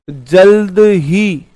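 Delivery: very slow, stretched जल्द ही; drawn-out emphasis. Shown as a demonstration. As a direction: stretch both words of जल्द ही out loudly and slowly. Teacher stretches the key word. (जल्द ही को खींचकर, धीरे-धीरे)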